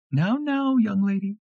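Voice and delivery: high, sweet gentle rebuke; sweet mock scold. A narrator's reading. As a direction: scold her sweetly, not serious. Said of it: "Saying it sweet".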